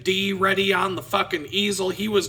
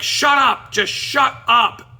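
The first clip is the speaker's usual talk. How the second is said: loud, high angry outburst; imitated angry judge yelling; mad judge; 6 semitones higher, 7 dB louder, slower than usual. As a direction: shout him down as a fed-up judge. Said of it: "he gets mad at the guy".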